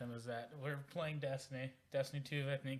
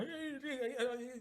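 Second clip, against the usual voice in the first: very high-pitched giddy squealing, imitating a friend; hammed-up giddy glee; 13 semitones higher, slower than usual. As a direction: mimic an overexcited friend in a high voice. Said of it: "being all giddy".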